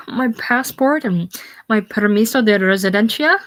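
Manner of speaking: high, dismayed exclamation; gasp, then lament a loss with rising alarm; acted panic. Acted panic over lost papers.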